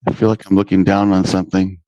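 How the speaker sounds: ordinary talk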